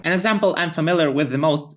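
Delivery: ordinary talk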